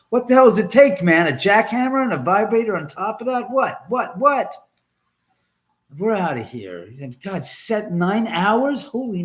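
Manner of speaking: high, loud incredulous teasing; mock outrage. Real, not acted. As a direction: rib a friend with loud disbelief. Mock outrage at friend in chat.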